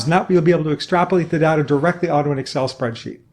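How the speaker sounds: fast, rattled-off instructions; rushed jargon rattle. Acted. Tech rep rushing instructions at a non-native customer, fast rattle. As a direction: race through the steps without pausing.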